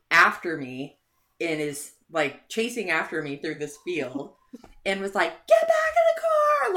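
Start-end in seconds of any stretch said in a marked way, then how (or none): 5.5-6.7 high, loud frantic yell at a runaway friend; much higher, louder mock shout, imitating a drunk friend. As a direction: shout it across the field. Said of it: "Like, yelling at me".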